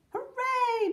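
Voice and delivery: high, drawn-out hooray; shouted cheer. A narrator's reading. Julie cheering her grandmother, high excited shout. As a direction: cheer in a high voice, holding the note. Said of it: "Julie shouted".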